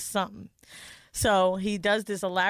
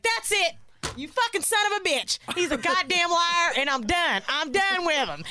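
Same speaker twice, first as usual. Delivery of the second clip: mock furious Angela; 8 semitones higher than usual. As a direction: shriek like a fed-up wife. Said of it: "She was like".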